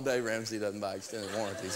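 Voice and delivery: soft, amused, half laughing; grinning quietly. Retold or imitated. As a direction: say it quietly through a grin. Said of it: "And I started grinning".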